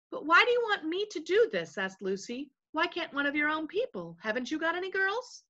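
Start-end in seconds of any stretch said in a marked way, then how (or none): 0.1-1.8 higher-pitched puzzled questioner; a touch of bewilderment, pitched up; lightly voiced puzzled Lucy. Lucy asks the invisible voices, lightly voiced puzzlement. 2.7-5.4 high, softer protesting question; questioning Lucy. Acted. Lucy asks the voices why, higher puzzled protest.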